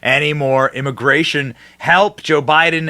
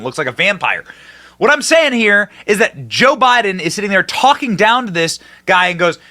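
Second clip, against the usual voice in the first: ordinary talk, nothing marked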